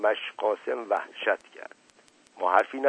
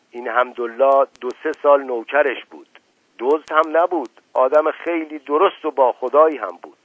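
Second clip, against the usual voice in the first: louder, insistent uncle spinning a conspiracy; worked-up uncle voice; 5 semitones higher, 6 dB louder than usual. A narrator's reading. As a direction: press the suspicion hard.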